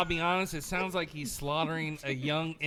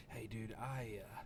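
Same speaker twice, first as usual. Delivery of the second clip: a hushed, guilty near-whisper, much softer; sheepish whisper; 8 semitones lower, 9 dB quieter than usual. Retold or imitated. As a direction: confess the mess under your breath. Imitating sheepish friend after puking.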